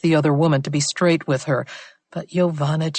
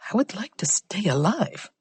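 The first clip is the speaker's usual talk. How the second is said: hushed, frightened whisper; frightened informant whispers her fear down the phone; 5 semitones higher, 5 dB quieter than usual. Acted. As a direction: breathe it out in secret.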